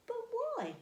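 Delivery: high, wondering question; playful puzzling. Acted. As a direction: ask it as a riddle. Teacher poses mystery question.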